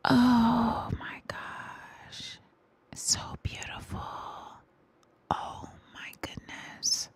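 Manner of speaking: awed whisper. Real, not acted. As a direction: whisper in awe. Awed by the beauty of music.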